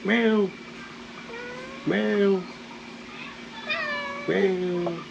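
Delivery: high playful meow; meowing at the cat. Pretend. Coaxing the cat, high mock meow.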